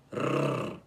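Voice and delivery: rrr, a rolled tongue trill; trilled R sound. Shown as a demonstration. Teacher showing the rolled R sound again. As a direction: give a long trilled rrr.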